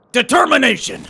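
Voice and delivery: high, loud battle cry; shouted rallying word. Acted. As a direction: fire up the troops. Rallying troops.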